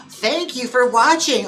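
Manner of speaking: touched and surprised by the compliment; pleasantly surprised thanks; delighted gasp. Real, not acted. Gasps at a fan compliment.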